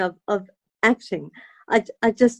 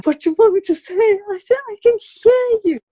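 high, louder with feeling; emotional; 11 semitones higher, 4 dB louder than usual. Retold or imitated. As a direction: let the joy break through. Emotional exchange with father.

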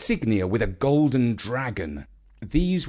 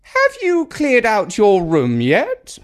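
put-on high, loud, slow old woman's demand; demanding Grandma; 10 semitones higher, 6 dB louder, slower than usual. A narrator's reading. Grandma demanding an answer from Nigel, high and slow. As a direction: insist like a bossy mother. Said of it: "she asked him".

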